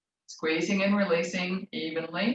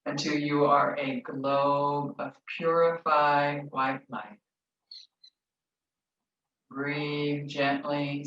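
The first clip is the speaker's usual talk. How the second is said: slow, calm meditation guide voice; slow guided visualization, drawn out; 2 semitones lower than usual. Acted. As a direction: ease them gently into stillness.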